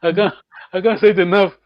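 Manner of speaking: ordinary talk, nothing marked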